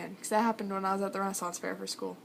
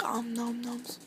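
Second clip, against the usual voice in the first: whisper; slower than usual. Pretend. Playful ice-munching voice.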